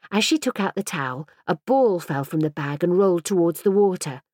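ordinary delivery

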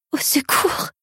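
high, thread-thin cry for help; thin sobbed plea. A narrator's reading. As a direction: sob it out with barely any voice. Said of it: "sanglotai-je dans un filet de voix".

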